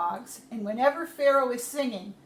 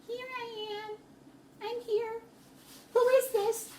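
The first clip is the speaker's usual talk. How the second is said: higher, timid voice; startled Moses; 11 semitones higher than usual. Acted. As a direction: answer unsure who is speaking. Moses answers the voice.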